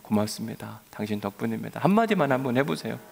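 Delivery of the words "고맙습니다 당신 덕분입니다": softer, sincere thank-you; gentle thanks. Shown as a demonstration. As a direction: say it softly to your neighbour. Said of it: "옆에 있는 분들에게 그렇게 이야기할게요".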